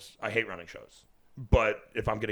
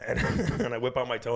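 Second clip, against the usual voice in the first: usual speaking voice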